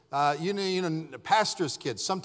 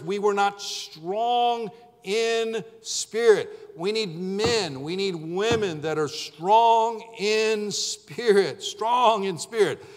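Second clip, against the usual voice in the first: slow, drawn-out preaching cadence; 4 semitones higher, much slower than usual. Acted. Pastor exhorting the congregation, slow and emphatic preaching. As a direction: hammer the phrase home from the pulpit.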